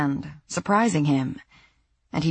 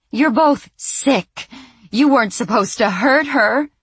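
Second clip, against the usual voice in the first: angry accusation; 8 semitones higher, 5 dB louder than usual. Acted. Sandy continues snarling accusation.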